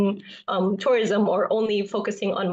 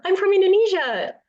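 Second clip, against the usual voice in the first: bright, much higher, louder proud reply; a student proudly telling a tourist where she is from, pitched up high; proud self-introduction; 10 semitones higher, 4 dB louder than usual. Retold or imitated. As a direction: announce it proudly to a foreigner. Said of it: "And I just wanted to, you know".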